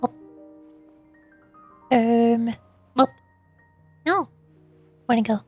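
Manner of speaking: usual speaking voice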